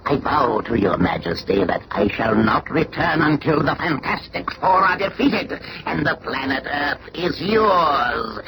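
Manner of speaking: ordinary talk, nothing marked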